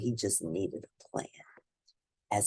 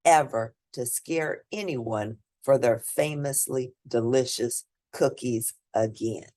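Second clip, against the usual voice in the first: ordinary delivery, nothing marked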